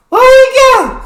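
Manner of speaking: very high, loud call-out; squealing hype call to start the video; hype shout. Acted. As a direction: shout the cue to kick off the clip. Host cues the clip.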